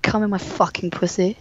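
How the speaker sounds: quiet quoting. Retold or imitated. Host retells how a woman said it, hushed. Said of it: "That's how she said it".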